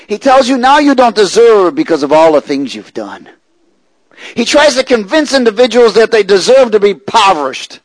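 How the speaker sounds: loud, high-pitched preaching at full force; forceful preaching. Real, not acted. Preacher exposing the devil's lies to the congregation, forceful preaching. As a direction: drive it hard at the congregation.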